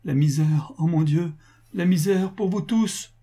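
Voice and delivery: high, anguished lament, acted; ruined man lamenting for his family; sobbing despair. A narrator's reading. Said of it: "au milieu de sanglots convulsifs".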